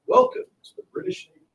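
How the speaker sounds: lightly voiced officer's welcome, high and airy; a hint of a pompous British officer, high and breathy; official voice. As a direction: announce it like a stiff navy officer. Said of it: "That voice, it's very official".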